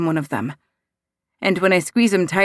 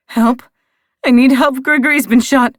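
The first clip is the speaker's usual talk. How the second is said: loud, higher, frantic plea through tears; crying for help; 6 semitones higher, 6 dB louder than usual. A narrator's reading. Daughter on the phone begging for help, loud and tearful. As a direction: sob it out desperately down the phone. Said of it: "I'm crying too hard to speak clearly".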